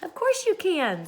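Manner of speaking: lightly voiced young girl; Annika, reassuring. A narrator's reading. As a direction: reassure her friend gently. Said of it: "said Annika".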